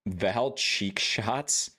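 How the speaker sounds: Val cheek shots drawn out, rising; slow incredulous question. Real, not acted. Reading the name slowly.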